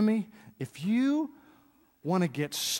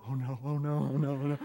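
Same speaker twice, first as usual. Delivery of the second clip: low, softer chorus of doom; kids dreading; 5 semitones lower, 5 dB quieter, faster than usual. Retold or imitated. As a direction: siblings dreading the dad speech, low and ominous. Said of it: "all the other kids go".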